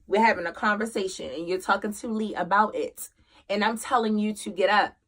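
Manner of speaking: ordinary delivery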